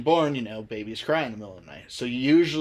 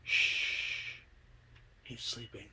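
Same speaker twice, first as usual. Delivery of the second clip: whispered shush, very soft; ghostly woman whispering softly; ghost's hush; 10 dB quieter, much slower than usual. Retold or imitated. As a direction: hush him like a sleeping baby. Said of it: "She turns around and goes".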